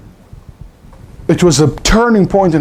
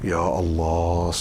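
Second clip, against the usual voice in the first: mock dua; 10 semitones lower, 5 dB quieter than usual. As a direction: drone it out like an overdone solemn supplication. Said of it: "nobody ever make dua like this".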